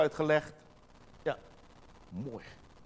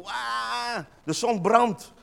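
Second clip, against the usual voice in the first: mock childish wail, higher pitch; whining child; 6 semitones higher, much slower than usual. People in the desert whining to God like small children. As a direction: whine like a small child about the sun. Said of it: "net als kleine kinderen soms kunnen roepen".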